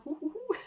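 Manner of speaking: high-pitched imitated Draco hooting; mock spooky "hou hou". Fan retelling Draco's reply to Shut up Malfoy, mocking hoots. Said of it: "Drago, il fait".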